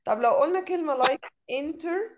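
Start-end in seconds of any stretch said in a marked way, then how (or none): 1.5-2.1 "internet" broken with a long pause before net; "internet" in two separated chunks, inter rising; split into syllables. Shown as a demonstration. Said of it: "أنا نطقتها وأنا بقرأها كام مرة؟".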